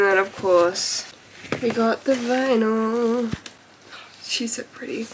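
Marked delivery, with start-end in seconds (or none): 4.2-5.1 whispered to herself, admiring; breathy whisper of delight; hushed whisper. Real, not acted.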